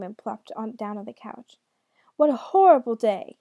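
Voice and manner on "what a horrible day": loud, exasperated shout; devil's angry outburst. A narrator's reading. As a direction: bellow it in frustration. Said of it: "he shouted".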